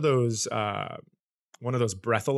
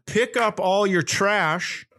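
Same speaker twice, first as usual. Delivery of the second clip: stern cop order; 7 semitones higher, slower than usual. Retold or imitated.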